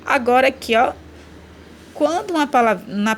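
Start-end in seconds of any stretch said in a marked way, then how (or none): none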